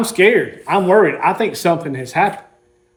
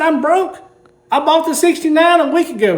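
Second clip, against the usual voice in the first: high-pitched mock despair; 10 semitones higher than usual. Repeating to Sean, exasperated.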